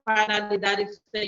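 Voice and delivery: ordinary delivery, nothing marked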